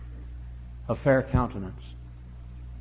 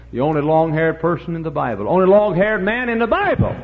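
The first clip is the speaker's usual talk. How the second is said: loud, climbing higher on the repeat; building to a shout; 6 semitones higher, 6 dB louder than usual. Real, not acted. Preacher on the Bible's long-haired man, building shout. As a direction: drive it up to a high preaching shout.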